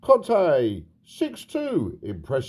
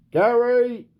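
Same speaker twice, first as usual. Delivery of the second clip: shouted call; 10 semitones higher, 6 dB louder than usual. Acted. Calls out to Gary.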